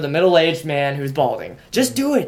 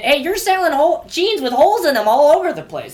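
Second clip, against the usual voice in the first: mock scolding shopper; 9 semitones higher than usual. Mock telling off the salesman.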